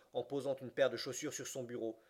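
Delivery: plain reading voice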